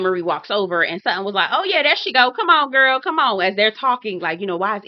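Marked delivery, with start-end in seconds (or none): 1.5-3.4 put-on higher, louder hype-girl cheer; egging her on. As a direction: hype up your friend as she arrives. Acts out Sutton cheering on.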